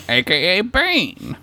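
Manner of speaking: slow, high mock Bane; a light Bane villain growl. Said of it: "like, Batman villain voices".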